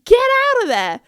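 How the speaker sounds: loud, high, exasperated plea; urgent warning. Retold or imitated. Phoning friends trapped in the shop, urgent shout. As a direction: shout at oblivious friends to move.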